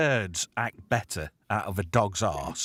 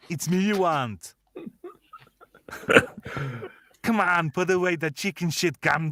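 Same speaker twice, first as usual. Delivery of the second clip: higher, loud, taunting villain; Bennett villain voice; 6 semitones higher, 4 dB louder than usual. Retold or imitated. Host acting Commando's Bennett taunting John, loud mock villain. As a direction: goad him like a gleeful villain. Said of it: "Tom says".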